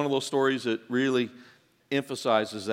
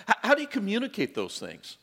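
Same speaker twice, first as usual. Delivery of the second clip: normal talking voice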